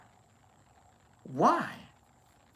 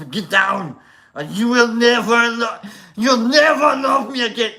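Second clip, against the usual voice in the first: loud, higher Arnold Schwarzenegger singing; 6 semitones higher, 7 dB louder than usual. Pretend. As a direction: sing it like Arnold, pumped up. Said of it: "He's Arnold".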